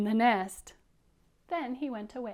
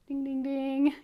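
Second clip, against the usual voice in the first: bell chime; 4 semitones higher, 7 dB louder than usual. Pretend. Imitates bell for spotting the nest.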